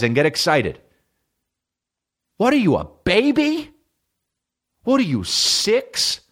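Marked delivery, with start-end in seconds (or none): 2.4-6.2 high mocking jab. Real, not acted. Roasting his friend over new fries, in a high mocking voice.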